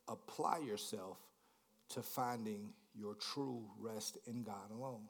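quiet repeat. Shown as a demonstration. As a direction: lean in and say it again softly. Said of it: "so let me say it again".

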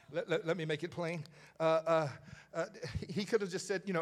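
ordinary talk, nothing marked

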